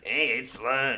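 lightly voiced slug character; cool cartoon slug. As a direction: greet them as a laid-back cartoon slug. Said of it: "like, a really cool slug".

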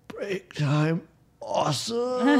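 high, squeaky snail character voice. Acted. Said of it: "the snail kind of there on the ground going".

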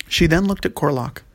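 usual speaking voice

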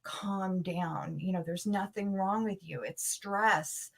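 a hint of a husband shushing her worry; husband calming her. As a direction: soothe her like a patient husband. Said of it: "and he's like".

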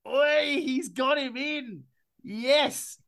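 high-pitched, drawn-out celebration; excited cheer. Real, not acted. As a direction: cheer your co-host's pick, way up high. Cheering a favourite pick.

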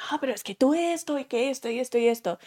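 fast, rattled-off imitation of a critical boss; fast mock scolding, words tumbling out; rapid mock boss rant. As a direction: rush through it like a boss nagging. Mimics the boss's public criticism.

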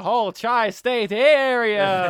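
building to a loud, stretched last word; a hint of grandiose evil scientist; lightly voiced cartoon villain. Telling friends the villain's plan, mock-grandiose villain.

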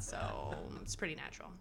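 unvoiced whisper tacked on; a whispered self-aware aside. Real, not acted. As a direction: toss it off in a whisper. Aside after joke.

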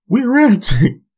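squeaky high mock voice, jokey; high cartoonish joke voice; silly high voice. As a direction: pitch way up into a goofy squeak on "We're renting". Punning ranting as renting.

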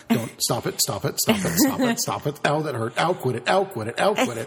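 rising, pleading stop-it protest, half laughing; mock whiny protest. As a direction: play the wife swatting him off in pain. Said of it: "she's, like, slapping me away".

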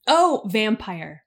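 ordinary delivery

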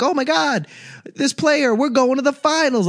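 high-pitched mocking overexcited fan; mock hyped fan. Podcaster mocking fans who overhype free agency, squealing high. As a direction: squeal it like a fan losing their mind over one signing.

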